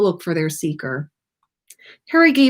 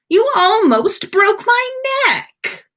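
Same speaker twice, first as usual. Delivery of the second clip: high, loud and slow complaint; indignant Draco; 5 dB louder, slower than usual. A narrator's reading. Draco complaining to Harry from under the pumpkin, indignant. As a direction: whine it out, wounded and offended.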